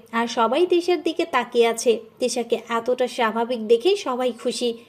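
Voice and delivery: ordinary delivery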